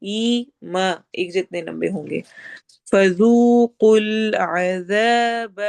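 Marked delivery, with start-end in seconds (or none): 2.9-5.6 slow, slightly louder chanted recitation; Quranic recitation. Shown as a demonstration. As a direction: recite with a heavy qaf, slowly. Teacher recites Quranic verse.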